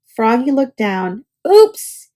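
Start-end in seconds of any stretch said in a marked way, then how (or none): 1.5-2.1 high, loud startled oops; a sudden embarrassed yelp. A narrator's reading. Caught in his underwear.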